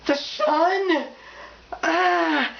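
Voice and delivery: gasping, high-pitched shock; mock horror. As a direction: recoil with a hammed-up gasp and cry. Imitates readers shocked by sunlight.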